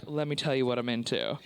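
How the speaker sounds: very low, mock-seductive voice; kinky man's confession. Man confiding kinks in the bedroom, mock sultry. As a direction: drop right down, imitating an over-eager lover.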